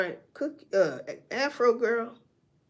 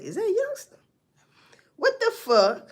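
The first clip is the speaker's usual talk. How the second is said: high, startled question; surprised; 11 semitones higher than usual. Retold or imitated. Bystander at the shooting, startled and asking in surprise. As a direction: ask it in sudden disbelief. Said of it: "You know, surprise".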